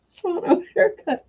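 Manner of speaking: strained high voice while choking. Real, not acted. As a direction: push the name out through a cough. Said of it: "I'm choking".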